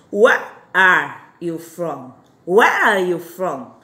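slow, emphatic classroom model; slow teaching demo. Shown as a demonstration. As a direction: stretch each word of Where are you from. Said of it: "você quer perguntar de onde".

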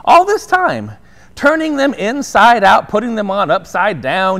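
usual speaking voice

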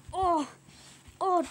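ordinary delivery

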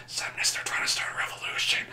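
hushed, voiceless tattling; conspiratorial whisper. Acted. Double agent informing the boss of a plot, secretive whisper.

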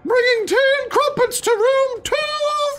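man doing a high, slow lady's voice. Pretend. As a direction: falsetto tea lady, drawn out. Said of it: "Why are you doing the lady's voice?".

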